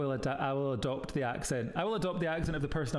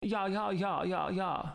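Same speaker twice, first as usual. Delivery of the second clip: mock Edinburgh student "yah, yah", pitched up; Edinburgh Uni accent; 5 semitones higher, slower than usual. Mocking the Edinburgh Uni accent for a Glasgow friend, exaggerated accent. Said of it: "The Edinburgh Uni accent is".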